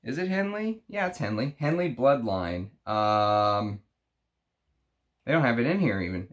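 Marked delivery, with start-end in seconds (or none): none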